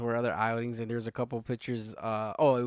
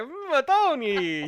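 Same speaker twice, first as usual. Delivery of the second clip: much higher, very slow, louder Italian American "Mm, Tony"; hammed-up Italian American crooning; over-the-top Italian American accent, drawn out; mock Italian accent; 13 semitones higher, 8 dB louder, much slower than usual. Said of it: "You're like".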